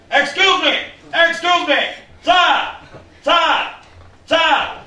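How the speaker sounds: loud, high-pitched heckler's holler; Edwardian heckler. Retold or imitated. Comic heckling the wrestler walking to the ring, loud Edwardian heckler. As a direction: call out sharply as an indignant period gentleman. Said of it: "I did the Edwardian heckler at the wrestling".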